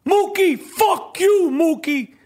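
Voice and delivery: high, shouted tough-guy rant at Mookie; Danny Aiello quote. Retold or imitated. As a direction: hurl the money at Mookie. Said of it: "how good Danny Aiello was in Do the Right Thing".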